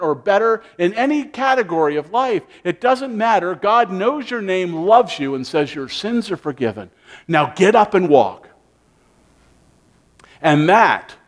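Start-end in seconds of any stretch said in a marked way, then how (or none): none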